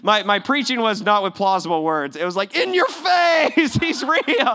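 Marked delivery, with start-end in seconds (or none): 2.5-4.5 high, drawn-out taunting shout; immature gloating. Retold or imitated. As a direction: crow it slowly, like a childish winner. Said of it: "totally immature".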